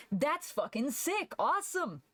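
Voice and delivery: high, excited cheer; a delighted viewer gushing over the art; mock excited fan. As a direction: hype it up like an impressed viewer. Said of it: "look at your art and go".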